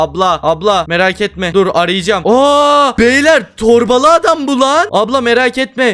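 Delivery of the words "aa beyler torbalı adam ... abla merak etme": loud, high-pitched cry of alarm; alarmed shouting. Real, not acted. Player spots the bag man and warns friends, alarmed. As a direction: shout the warning in a panic.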